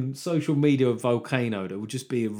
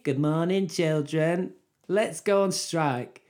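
mock schoolteacher, pitched up; Rebecca Long Bailey; 5 semitones higher, 3 dB louder, much slower than usual. Teacher urges her class to strike, mock schoolmistress voice. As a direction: address the class in a raised, measured teacher voice. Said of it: "I would go with Rebecca Long Bailey".

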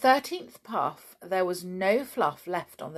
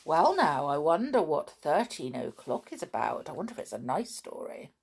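ordinary delivery

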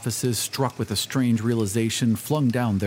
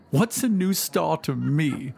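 higher, grief-stricken cry, a touch of tears; weeping woman; 7 semitones higher than usual. A narrator's reading. A weeping woman beside her dead cries out in grief. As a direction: cry out in bereaved despair. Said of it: "cried the weeping woman".

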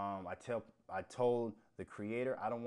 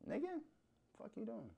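incredulous outburst; 12 semitones higher, 6 dB quieter than usual. Real, not acted. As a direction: pitch leaps way up in disbelief. Incredulous outburst at low scores.

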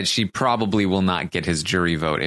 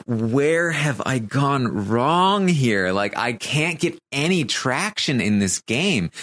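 drawn-out, higher-pitched exasperated wail, imitated; mock tearful lament; 5 semitones higher, slower than usual. Kevin venting to the cameras, hammed-up plaintive lament. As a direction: play up the despair with swooping, stretched words. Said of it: "He's crying to her".

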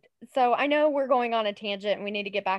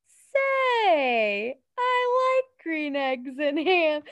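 drawn-out, high storybook character voice; character read-aloud voice; 5 semitones higher, 4 dB louder, much slower than usual. Shown as a demonstration. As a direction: stretch it out big and loud, reading to a child. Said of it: "That's how I say it".

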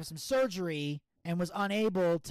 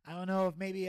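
ordinary talk, nothing marked

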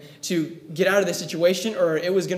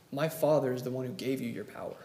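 softer and lower, unhurried; Jesus calmly answers Pilate; 5 semitones lower, 8 dB quieter than usual. Retold or imitated. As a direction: state it with serene certainty. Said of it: "tells Pilate very calmly".

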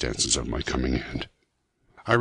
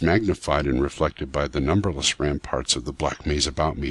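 usual speaking voice

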